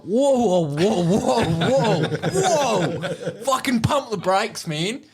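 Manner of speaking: high, drawn-out panicky whoas; alarmed protest. Retold or imitated. Patient panicking at the doctor, high alarmed whoas. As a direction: stop her in a laughing panic. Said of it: "And I'm like".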